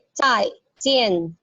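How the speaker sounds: slow "zai jian", both falling; fourth tone demo. Shown as a demonstration. Teacher drills it slowly for class. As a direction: stretch "zai jian", each syllable falling. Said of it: "dua-duanya nada keempat".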